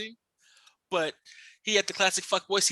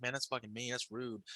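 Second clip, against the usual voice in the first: low, very soft retold aside; quiet disapproval; 6 semitones lower, 15 dB quieter than usual. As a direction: disapprove under your breath, imitating a coworker. Coworker quietly disapproving of insults.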